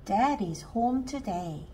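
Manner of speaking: ordinary delivery, nothing marked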